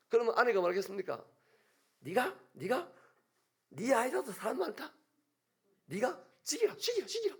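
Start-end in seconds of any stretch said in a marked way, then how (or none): 2.0-7.4 much higher shouting, climbing each time; shrieking dare. Acted. Eve screaming at Adam to go ahead and kill her, shrieking. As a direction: scream it at him, daring him.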